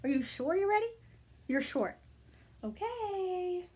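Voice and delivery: plain reading voice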